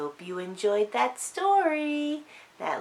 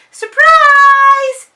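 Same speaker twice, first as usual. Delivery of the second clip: high, loud squeal of surprise; delighted loud cry of surprise; excited cry; 17 dB louder than usual. A narrator's reading. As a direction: burst out with a shout. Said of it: "cried Dylan".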